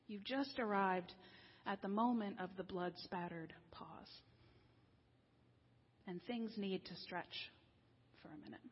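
hushed whisper on the key phrase; softly whispered climax. Real, not acted. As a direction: drop to a breathy whisper. Sermon's quiet climax.

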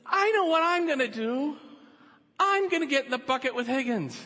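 high, loud eager cry; eager shout. Acted. Eager droplet calls from crowd.

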